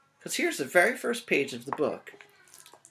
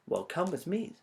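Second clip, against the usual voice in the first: sly fox; 3 semitones higher, 5 dB quieter than usual. Acted. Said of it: "said Foxy Loxy".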